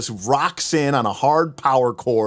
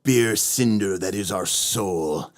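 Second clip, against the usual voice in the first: brooding edgelord; 5 semitones lower, slower than usual. Acted. Edgy shadow intro voice.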